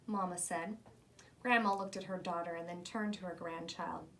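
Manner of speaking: ordinary delivery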